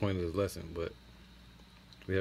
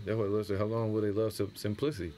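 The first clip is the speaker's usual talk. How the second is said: ordinary delivery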